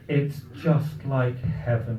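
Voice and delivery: slow, soft, mock-reverent murmur; hushed awe. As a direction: savour it slowly, as if awestruck. Wife awestruck in food hall.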